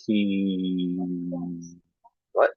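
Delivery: "He" then a pause, then "what?" rising; partial repetition. Shown as a demonstration. Teacher stops before a student's error to prompt them. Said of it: "you pause or you stop before the error".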